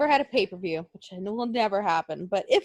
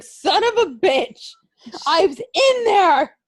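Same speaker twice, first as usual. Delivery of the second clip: high, loud, slow furious yell from inside the coffin; mock angry yell; 7 semitones higher, 6 dB louder, slower than usual. Wrestler dropped in his coffin yells at the druids, mock furious. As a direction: bellow it out, drawn-out and outraged.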